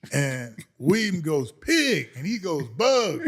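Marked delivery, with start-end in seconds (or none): 1.5-2.1 high, loud nickname greeting; calling out. Retold or imitated. Roommate greeting his cousin in the lobby, high loud call. 2.7-3.2 raised, loud answering greeting; calling out. Retold or imitated. Cousin answering his greeting in the lobby, high loud call. Cousin calls the nickname back.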